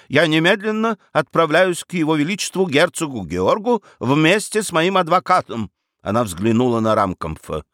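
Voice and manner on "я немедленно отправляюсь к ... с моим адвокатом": higher, determined announcement; resolute. A narrator's reading. Baroness setting off to petition the duke, resolute. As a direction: announce it firmly, pitch raised. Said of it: "решительно воскликнула баронесса".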